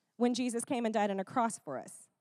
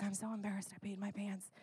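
put-on much softer, shamed little boy; embarrassed little boy; 14 dB quieter, faster than usual. Boy who wet himself to Adam Sandler, very soft. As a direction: mumble it like a kid hiding his face. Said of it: "little boy super embarrassed".

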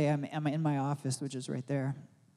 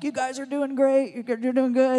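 high, louder encouraging call; cheering onlookers; 10 semitones higher, 6 dB louder than usual. Retold or imitated. People in the fire tunnel encouraging the couple, high cheering. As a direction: cheer them on through the tunnel. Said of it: "we hear people, like, saying".

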